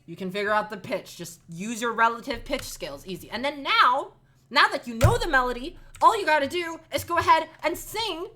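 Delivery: ordinary talk, nothing marked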